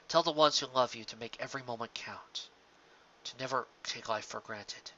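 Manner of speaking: a touch of a soft, sorrowful farewell; lightly voiced farewell plea. Dying stallion to the mare, a soft regretful farewell. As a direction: say goodbye gently, holding back regret. Voiced character's last words.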